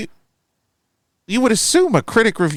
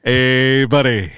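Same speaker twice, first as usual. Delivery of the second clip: lightly hammed-up celebrity greeting; jokey, stretched-out mock phone greeting; Robin Williams; 3 semitones lower, slower than usual. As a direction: draw out each word in a bad comedian impression. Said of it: "Just do really bad Robin Williams impressions".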